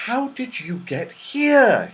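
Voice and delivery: high, slow, wondering question; astonished. A narrator's reading. Joe hugs his sisters, astonished. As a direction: ask in drawn-out amazement. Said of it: "he asked".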